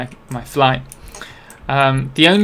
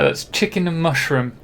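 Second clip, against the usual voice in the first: normal talking voice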